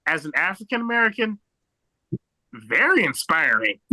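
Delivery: usual speaking voice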